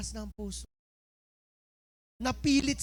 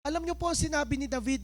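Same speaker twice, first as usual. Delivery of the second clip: ordinary delivery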